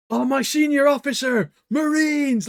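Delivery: high, keen shout of a junior marine; mock eager soldier. Wu Jin greeting his senior officer, high mock eager. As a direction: salute your senior with overdone zeal. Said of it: "Wu Jin's always like".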